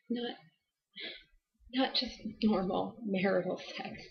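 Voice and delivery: soft, halting delivery with long pauses; hushed confession. Acted. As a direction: confide it quietly, a few words at a time. Halting confession of marital abuse.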